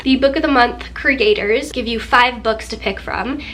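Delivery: ordinary talk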